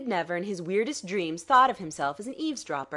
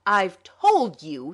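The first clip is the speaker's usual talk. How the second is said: slow, louder, choked with rage; Mulready leaping up in a rage, thick-voiced; voice thick with passion; 2 semitones higher, 9 dB louder, much slower than usual. A narrator's reading. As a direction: force it out through fury. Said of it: "in a voice thick with passion".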